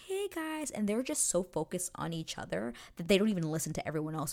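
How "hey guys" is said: high-pitched, tentative interruption; intervening bystander. Retold or imitated. Outsiders trying to break up bickering, high-pitched. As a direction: pipe up high to get their attention. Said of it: "trying to be like".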